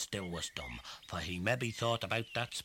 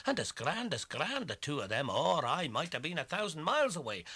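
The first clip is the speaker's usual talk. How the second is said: excited storytelling; 6 semitones higher, 3 dB louder than usual. A narrator's reading. Excited retelling of chatter.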